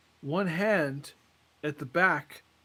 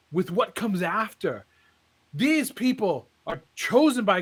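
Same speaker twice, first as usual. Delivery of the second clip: a revival preacher whipping up cheers; exultant preacher; 3 semitones higher than usual. Retold or imitated. Preacher exults before the crowd.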